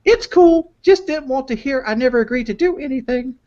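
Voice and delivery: put-on high falsetto girlfriend voice reading texts; girly voice. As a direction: read her lines in a squeaky girly voice. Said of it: "I got fucking up with the voice".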